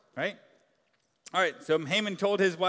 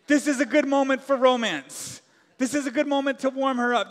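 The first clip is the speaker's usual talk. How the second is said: higher, louder sarcastic mock line; mock Haman; 7 semitones higher, 8 dB louder than usual. Preacher mocking Haman's supposed intent, louder and sarcastic. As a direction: ham up the absurd romance. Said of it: "Haman is like".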